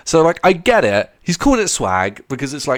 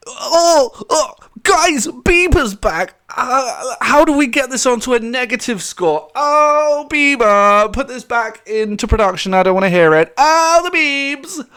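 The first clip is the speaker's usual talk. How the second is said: high, loud hammed-up wailing; mock anguish; 11 semitones higher, 4 dB louder, slower than usual. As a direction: wail in exaggerated dismay, dragging out the groans. Mocks outrage at [name]'s return.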